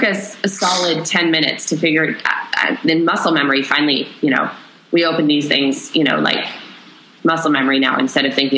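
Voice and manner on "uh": normal talking voice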